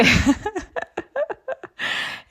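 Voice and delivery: bursting into a high giggle; a squeaky giggle at the horse; high laugh. Real, not acted. Amused by the lazy horse, a high giggle.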